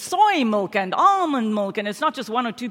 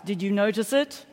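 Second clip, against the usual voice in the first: ordinary delivery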